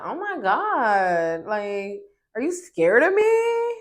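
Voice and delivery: mock her taunting question; mocking Shanice. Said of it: "she was just like".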